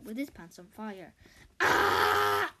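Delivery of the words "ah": loud, very low scream. Acted. Terry screaming with his pants on fire, loud low scream. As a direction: let out a burning yell.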